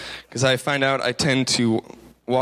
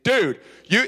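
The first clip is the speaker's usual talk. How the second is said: laid-back bro; 6 semitones higher than usual. Retold or imitated. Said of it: "that's how my brother talks".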